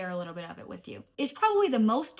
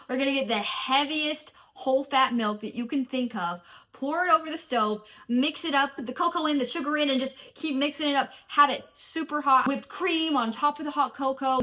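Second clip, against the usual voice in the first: higher, louder eager rundown; excited; 4 semitones higher, 4 dB louder than usual. Real, not acted. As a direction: gush about the recipe. Enthusing about cocoa.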